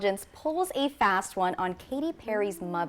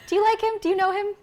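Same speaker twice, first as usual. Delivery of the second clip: excited teasing; 12 semitones higher, 4 dB louder, faster than usual. Real, not acted. Teasing question to co-anchor.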